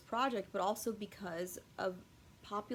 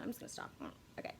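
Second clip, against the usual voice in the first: ordinary delivery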